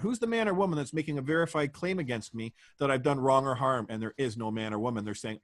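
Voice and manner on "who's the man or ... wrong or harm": usual speaking voice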